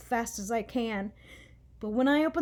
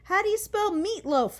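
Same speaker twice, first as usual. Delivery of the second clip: calling out; 9 semitones higher, 5 dB louder than usual. A narrator's reading. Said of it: "I call to her".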